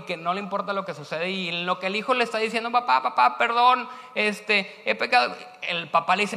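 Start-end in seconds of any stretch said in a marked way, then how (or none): 2.7-5.3 higher, louder breathless plea; urgent pleading son. Acted. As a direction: blurt out the apology. Son pleads as father runs.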